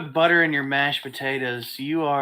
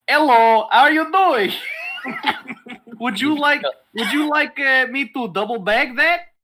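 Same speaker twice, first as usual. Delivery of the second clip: mock Russian cashier accent, higher and louder; 9 semitones higher, 5 dB louder than usual. Imitating the Russian cashier at the convenience store in a mock Russian accent. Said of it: "he has a Russian accent and the broken English".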